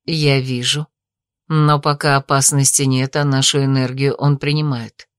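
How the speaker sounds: low, level character voice; deep-pitched measured reply; calm mother figure. A narrator's reading. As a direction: answer steadily, well down in pitch. Mother answers in lowered character voice.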